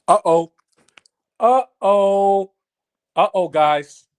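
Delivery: mock alarm at a troll in chat; playful uh-oh. Troll enters the live chat.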